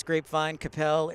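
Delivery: ordinary delivery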